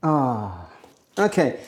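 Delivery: ordinary delivery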